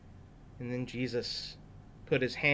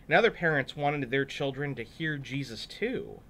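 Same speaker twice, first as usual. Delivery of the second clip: normal talking voice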